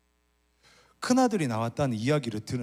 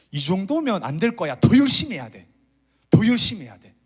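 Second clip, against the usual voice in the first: louder and higher, driven self-urging; anxious striving; 6 semitones higher, 10 dB louder than usual. Acted. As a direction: push yourself harder, voice rising. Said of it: "혹은 반대로". (더 크게, 스스로를 다그치듯)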